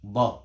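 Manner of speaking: Dad sounded alone with its Arabic articulation. Shown as a demonstration. Teacher showing the letter Urdu lacks, Dad pronounced the Arabic way. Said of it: "you don't have Dad".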